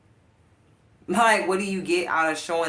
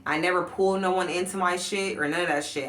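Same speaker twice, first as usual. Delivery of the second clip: ordinary delivery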